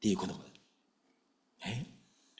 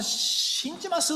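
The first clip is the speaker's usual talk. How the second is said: bold declaration; 12 semitones higher, 3 dB quieter, slower than usual. Retold or imitated. Father's bold confession quoted.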